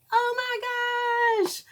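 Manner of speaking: slow, very high thrilled squeal; drawn-out swooning oh my gosh. Real, not acted. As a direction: squeal over the beautiful red. Admiring beautiful red.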